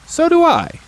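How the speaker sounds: high, loud happy bear voice; Pooh's reply. Acted. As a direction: chirp it back gladly. Pooh answers in his voice.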